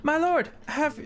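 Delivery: high, eager halfling servant. Acted. Halfling asks his lord about the horses, high and eager. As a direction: fuss over your lord, high and quick. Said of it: "And he".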